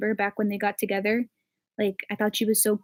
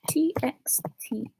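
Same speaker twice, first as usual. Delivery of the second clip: whispering; 8 dB quieter, slower than usual. Real, not acted. Typing a search aloud.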